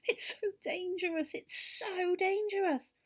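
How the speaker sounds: high, loud, slow wail of fright; frightened crying out. Acted. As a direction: scuttle off wailing in fright.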